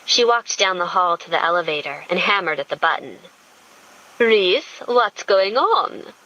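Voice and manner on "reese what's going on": put-on sultry European-accented question; a thick, sultry European lilt. A narrator's reading. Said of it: "thick and sultry European accent".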